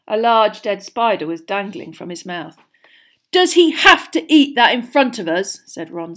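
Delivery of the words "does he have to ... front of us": louder, higher irritated protest; scowling complaint. A narrator's reading. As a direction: grumble loudly in annoyance.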